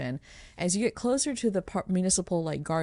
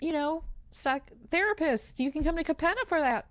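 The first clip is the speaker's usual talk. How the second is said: higher, lilting public address; melodious voice; 8 semitones higher, faster than usual. Acted. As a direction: gently address the crowd in a raised, melodic pitch. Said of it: "says in her melodious voice".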